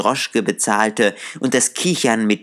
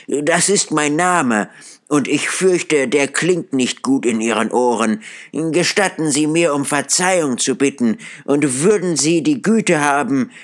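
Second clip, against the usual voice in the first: put-on higher, earnest old man asking pardon; humbly keen to make amends; eager Scrooge; 6 semitones higher than usual.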